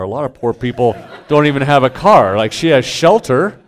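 father arguing with his daughter about the poor scale; laughing protest. Retold or imitated. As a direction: argue the point while laughing. Mock argument with daughter.

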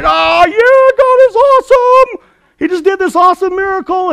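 high, loud stadium cheer; acted-out jubilant whooping; so excited. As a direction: holler it like a crowd celebrating at a game. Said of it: "They're so excited".

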